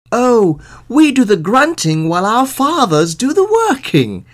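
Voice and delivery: high, loud cheerful answer; foolish young men. A narrator's reading. Young men answering the sheriff, high and loud.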